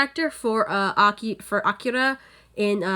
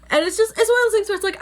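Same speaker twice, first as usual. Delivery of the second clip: ordinary talk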